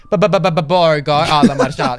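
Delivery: rapid repeated syllables, then nonsense words; imitated babbling. Imitating the streamer he ruined, mock babbling. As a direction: babble like a broken-brained streamer. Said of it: "constantly he was saying".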